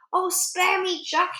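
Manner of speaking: high-pitched begging cry; yelled plea. A narrator's reading. Giant begging Jack for mercy, yelling. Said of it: "he yelled".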